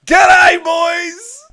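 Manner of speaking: huge, high, drawn-out holler; shouted greeting. Real, not acted. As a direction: bellow a rowdy hello to the lads. Stream opening greeting to the viewers.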